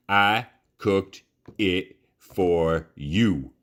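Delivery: very slow, heavy threat; slow menace. Acted. Neil slowly presses Bryce about the meal, menacing.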